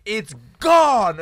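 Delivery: loud blunt yell of the brother; retold shout. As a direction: bark it out like a fed-up little brother.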